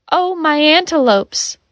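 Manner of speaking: high-pitched, falling lament; mild cry. A narrator's reading. Asher climbing into the car in feigned shock, mild cry. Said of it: "Asher cried and climbed".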